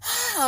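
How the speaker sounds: sudden high-pitched shriek, held back in volume; a short, sharp cry of shock, re-enacted; high scream from the story, not full force; retold scream. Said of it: "I'm screaming".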